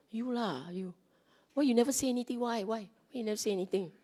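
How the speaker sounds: mock Singaporean nagging the monk; Singlish blaming. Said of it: "our Singlish version".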